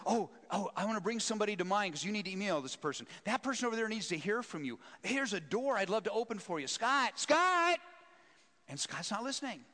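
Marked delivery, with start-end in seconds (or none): 0.1-6.7 higher, louder, pleading God; a God eager to get through; lightly voiced, urgent God. God voiced eagerly calling Scott. 6.7-7.8 much higher, loud call of a name; rising shouted call. Retold or imitated. God calling Scott, unheard.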